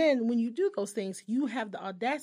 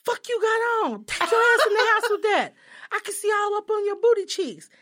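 loud, high mock holler from a car; retold yell; 11 semitones higher, 8 dB louder than usual. Ex-boyfriend yelling at her from the car, loud high holler. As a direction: shout it out the window at her. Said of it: "yells out the window".

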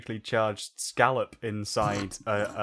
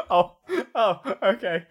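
high, loud, with a laugh; a son embarrassed in front of his dad, high and laughing; 9 semitones higher, 7 dB louder than usual. Retold or imitated. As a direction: caught out, laughing it off. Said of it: "He's like".

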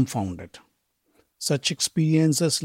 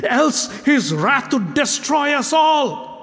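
urgent plea; 8 semitones higher than usual. Acted. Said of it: "Uttar's urgent plea".

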